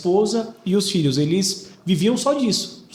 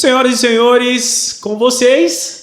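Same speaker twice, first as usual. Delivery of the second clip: slow, loud, high host fanfare; grand entrance announcement; 6 semitones higher, 7 dB louder, slower than usual. Acted. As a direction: announce the guest like a showman. Host introduces the guest. (anúncio de entrada triunfal)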